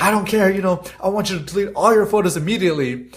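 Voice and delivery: imitating the angry lady. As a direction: pitch up and insist, half-hearted anger. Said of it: "And she's like".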